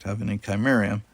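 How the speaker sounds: plain reading voice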